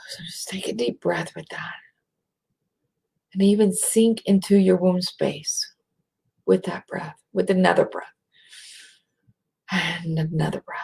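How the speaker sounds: soft meditation whisper; guided breathing. Acted. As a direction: lead them into breath, hushed.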